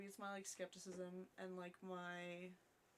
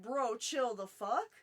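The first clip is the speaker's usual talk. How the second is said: loud, higher, slow indignant outburst; indignant retort; 4 semitones higher, 14 dB louder, much slower than usual. Retold or imitated. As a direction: snap back loudly, drawing the words out. Retold outburst at bus passenger.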